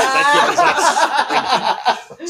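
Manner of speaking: laughing loudly through words. Real, not acted. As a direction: crack up while telling it. Laughing at the spaghetti joke.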